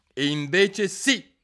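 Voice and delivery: flat contradiction; king contradicting Alice; king retorting. A narrator's reading.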